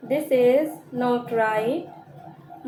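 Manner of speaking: This is not right, drawn out slowly word by word; slow it right down for learners; slow repeat. Shown as a demonstration. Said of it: "teacher repeats the sentence slowly". (धीरे-धीरे दोहराना)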